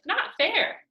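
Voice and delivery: high, loud protest; whiny complaint. Acted. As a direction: wail it like a wronged kid. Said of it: "Steph complained".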